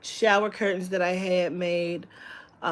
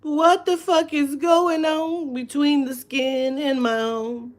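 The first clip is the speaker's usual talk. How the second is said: high, slow, baffled body voice; a much higher, drawn-out bewildered inner voice; her body talking; 7 semitones higher, 4 dB louder, slower than usual. Retold or imitated. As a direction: voice your own confused body. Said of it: "my body is over here like".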